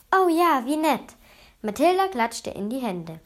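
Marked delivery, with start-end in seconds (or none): 0.1-1.2 high, loud burst of delight; thrilled little exclamation. A narrator's reading. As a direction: gush eagerly, starting high. Mathilda delighted at having a visitor.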